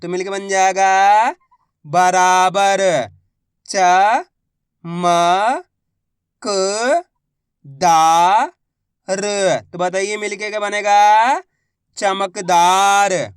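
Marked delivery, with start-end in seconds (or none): 3.6-9.6 very slow, each syllable drawn out. Shown as a demonstration. Teacher sounding out a word for pupils, slow syllables. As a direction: spell the word out slowly for a child. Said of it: "मैं किस तरीके से".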